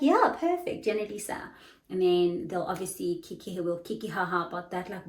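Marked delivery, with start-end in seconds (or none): 0.0-1.5 high bright yeah perfect; delighted praise. Retold or imitated. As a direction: praise her pronunciation with delight. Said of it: "And I'll be like".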